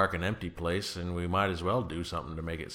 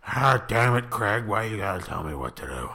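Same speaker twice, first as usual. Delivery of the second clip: put-on grumpy old man; 4 dB louder than usual. Mock complaint in character.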